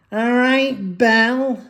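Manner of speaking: high, sing-song fake-sweet greeting; mocking the mum. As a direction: sneer at her sugary hello. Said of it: "Silly cow".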